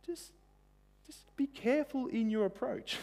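high, soft cautioning voice of God; God cautioning people, soft and high; gentle warning. Acted. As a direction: warn them gently from above. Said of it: "God's saying to us".

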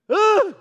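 sudden loud gasp of shock; high startled shout; startled yell. Acted. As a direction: jolt awake and cry out in horror. Jacob waking beside wrong wife.

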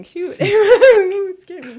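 tearful laugh, voice climbing high; choking up and laughing it off; starting to cry. Real, not acted. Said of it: "I'm gonna start crying".

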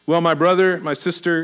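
ordinary talk, nothing marked

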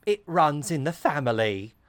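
lilting Cockney punchline; Cockney character. Retold or imitated. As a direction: say it knowingly in hammed-up Cockney. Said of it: "And she says".

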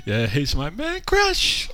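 squeaky high mimic; imitating high voice. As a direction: echo the high pitch back teasingly. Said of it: "It went high".

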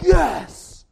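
mock delight at the villain's fate; gleeful cheer. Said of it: "And you're like".

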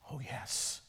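faint breathy whisper of a dying woman. A narrator's reading. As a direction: whisper it weakly, barely voiced. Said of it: "she whispered".

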